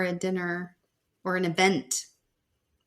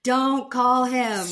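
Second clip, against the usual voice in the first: slow, loud warning; drawn-out, loud don't call him; group warning; 3 semitones higher, 7 dB louder, much slower than usual. Retold or imitated. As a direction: everyone begging her not to call, loud and slow. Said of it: "And everyone's like".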